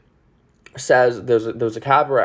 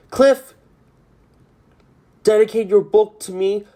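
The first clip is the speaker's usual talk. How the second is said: higher and a bit louder call after him; Sally calling after; 8 semitones higher, 4 dB louder than usual. Retold or imitated. Sally calling to Cliff as he leaves, higher and louder.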